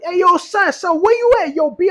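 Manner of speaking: put-on high, animated New York accent; hammed-up New York street voice. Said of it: "my New York accent".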